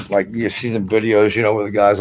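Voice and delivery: normal talking voice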